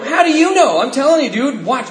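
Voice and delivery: high, insistent retort; indignant protest. Retold or imitated. Acts out arguing with his sponsor.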